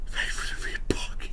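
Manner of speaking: hushed whispered line. Retold or imitated. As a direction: whisper it breathily. Ace Ventura quote whispered.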